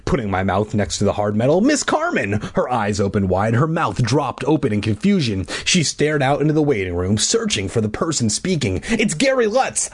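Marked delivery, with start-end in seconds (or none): 1.6-2.4 high-pitched mock shout. A narrator's reading. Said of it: "I shouted". 8.9-9.9 high acted call. A narrator's reading. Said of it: "I called out".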